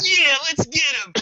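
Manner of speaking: high, slow dim-witted grunt; squeaky Decepticon voice. Retold or imitated. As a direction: belt it out like a dumb robot henchman. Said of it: "Do your Decepticon squeaky voice".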